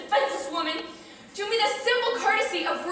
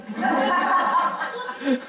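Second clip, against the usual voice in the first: insistent; much slower than usual. Acted. Woman pushing certificate.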